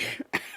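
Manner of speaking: sharp cough from bruised ribs; a painful little cough; coughing fit. Real, not acted. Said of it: "See, there we go again".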